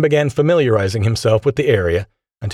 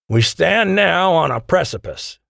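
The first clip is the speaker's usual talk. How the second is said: slow, raised-pitch transatlantic oratory; Dorian, transatlantic accent; 8 semitones higher, slower than usual. A narrator's reading. Charismatic leader rousing his scientists, transatlantic accent. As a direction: make a visionary speech in a transatlantic accent. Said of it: "in a transatlantic accent".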